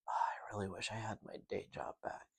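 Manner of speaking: hushed breathy quote; whispering. Retold or imitated. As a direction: whisper it like a secret.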